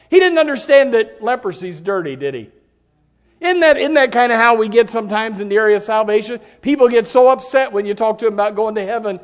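loud, high, driving preacher voice; fiery preaching. Acted. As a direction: preach it with full force to the congregation. Preaching at full force.

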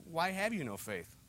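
soft, slow, gentle question; hushed, tender reproof; comforting. Acted. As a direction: ask it low and unhurried, without rebuke. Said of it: "Let me give you some comfort".

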